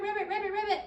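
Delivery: mock frog ribbit for a children's guessing game; frog croak. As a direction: croak it out for the kids like a playful frog. Teacher imitates frog sound.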